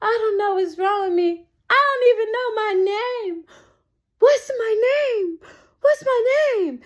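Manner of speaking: high, wailing mock panic; freaking out. High girl at work forgetting her name, acted freak-out. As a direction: wail it in hammed-up, tearful panic. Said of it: "then I started freaking out".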